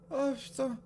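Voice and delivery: ordinary talk, nothing marked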